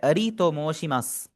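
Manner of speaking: अरी तो मोशिमास said slowly, अरी with a tapped r; teacher modelling the Japanese phrase; slow Japanese demo. Shown as a demonstration. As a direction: spell out अरी तो मोशिमास carefully for the class. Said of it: "आप बोलें अपना नाम पहले".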